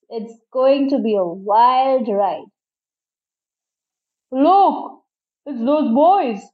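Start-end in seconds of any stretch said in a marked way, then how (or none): 4.3-6.4 deep foghorn bellow. A narrator's reading. Freighter man spots the boys below, moderate bellow. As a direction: call out like a foghorn, without full force. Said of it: "deep voice bellowed like a foghorn".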